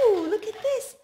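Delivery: high, loud gasp of wonder; excited gasp. Acted. Parent gasping at a picture for a toddler, delighted.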